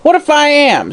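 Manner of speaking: high snarled crone; snarling hag. Acted. Hag snarling at the detective, high. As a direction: snap back like an old witch. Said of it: "snarled the woman".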